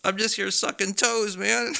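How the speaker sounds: high, breezy mock burglar; high, laid-back intruder impression; casual burglar voice. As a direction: say it like it's totally normal. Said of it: "the guy's just like".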